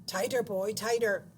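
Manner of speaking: loud, sharp headmaster command; snapped order. A narrator's reading. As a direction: snap it out at the boy. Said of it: "Mister Coombs snapped out".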